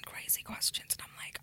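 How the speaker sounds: hushed, breathy aside to listeners; whisper. Real, not acted. Whispering to podcast listeners about crazy questions. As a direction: drop to a secretive whisper.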